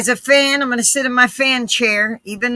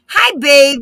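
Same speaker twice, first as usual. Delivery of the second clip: louder, sweet greeting to a puppy; cooing at a pet; 3 semitones higher, 6 dB louder than usual. Real, not acted. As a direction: coo at the dog. Greeting the dog.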